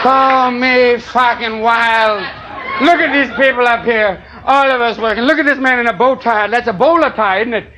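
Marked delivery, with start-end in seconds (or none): 0.0-2.2 slow dramatic. Acted. Comic topping his own dramatic line to the crowd, very slow. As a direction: stretch every word out grandly.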